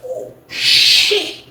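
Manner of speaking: hushed, drawn-out hiss of disbelief; retold shock. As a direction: breathe it out in stunned shock.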